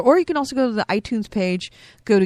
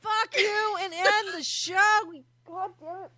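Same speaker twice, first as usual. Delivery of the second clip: choking; 10 semitones higher, 5 dB quieter than usual. Real, not acted. Choking host snaps at co-host.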